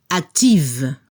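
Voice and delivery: active ending in a voiced v; active, buzz the v at the end; final V heard. Shown as a demonstration. Teacher correcting the quiz answer. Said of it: "se debe escuchar el sonido V".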